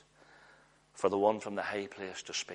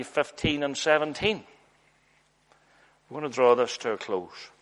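loud, rising preacher's call to the congregation; full-force preaching; 3 semitones higher, 5 dB louder than usual. Acted. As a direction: preach it out, building fire.

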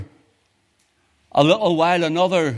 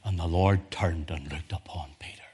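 reverent hush; 7 semitones lower, 9 dB quieter than usual. Acted. Quiet, reverent scripture meditation.

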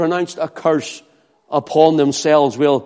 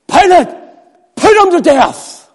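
much higher, loud demand; crowd shout; 12 semitones higher, 6 dB louder than usual. Acted. The crowd yelling at Pilate, high loud demand. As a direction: yell at Pilate like the mob.

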